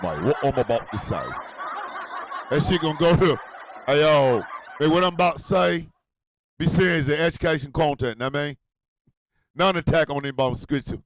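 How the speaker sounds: put-on loose, casual Atlanta accent; playful Atlanta-accented take-off; Atlanta accent impression. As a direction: redo the disclaimer in Atlanta street talk. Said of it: "gonna sound a little bit different if your ass live in Atlanta".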